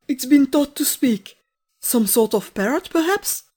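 high, loud astonishment; startled gentleman. Acted. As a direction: guess in amazement, rising at the end. Gentleman startled by talking bird.

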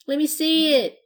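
high, loud eager plea; little pirate tugging at her skirt, pleading. A narrator's reading. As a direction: beg like an impatient little kid.

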